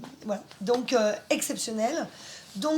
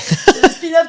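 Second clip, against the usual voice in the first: said again much louder, every word hammered; louder repeat; 5 semitones higher, 14 dB louder, slower than usual. Shown as a demonstration. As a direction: repeat it loudly so she keeps up. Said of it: "Faut suivre". (répété beaucoup plus fort, en martelant)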